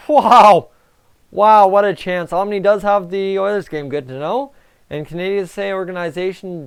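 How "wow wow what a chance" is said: loud, thrilled wow; excited wow. Real, not acted. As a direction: gasp at a near goal. Big scoring chance, excited.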